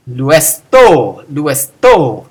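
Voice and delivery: "Luesto" with a loud, long TO; stress on ending. Shown as a demonstration. Teacher showing imperative "let him be loosed", stressed ending. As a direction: punch the last syllable, "luesTO". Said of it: "emphasize that weird ending".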